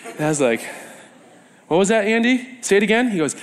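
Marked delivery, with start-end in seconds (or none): 1.7-3.1 raised pitch, coaxing a repeat; lightly acted higher-pitched question; puzzled asking. Asking Andy to repeat himself, puzzled higher voice.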